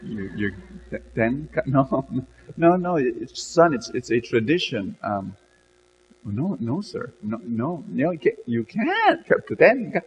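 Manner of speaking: flustered, broken-off stammer; stammering in shock. Acted. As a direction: stumble over the words, lost for speech. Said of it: "lost his voice for a while, and he spoke gibberish".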